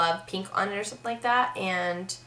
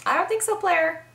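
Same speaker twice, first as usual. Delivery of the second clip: high, sassy "I don't think so, player."; sassy catchphrase; 9 semitones higher than usual. Shown as a demonstration. Brushing off a flirt with a high, sassy catchphrase. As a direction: throw it up high with attitude.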